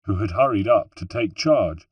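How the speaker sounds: plain reading voice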